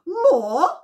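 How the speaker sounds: high, loud, incredulous cry; outraged disbelief. Acted. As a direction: shoot the pitch up in scandalised shock. Bumble reacts to outrageous request.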